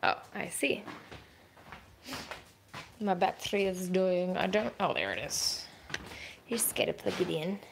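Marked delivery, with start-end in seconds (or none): none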